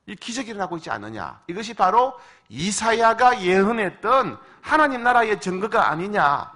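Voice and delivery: loud, high preaching as Jesus; preaching Jesus' words. Acted. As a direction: proclaim the miracles at full force. Preacher voicing Jesus loudly.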